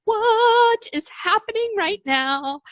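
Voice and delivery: high, slow, awestruck; dazed wonder. Retold or imitated. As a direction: drift off in amazed disbelief. Mock dazed reaction to hypnotic voice.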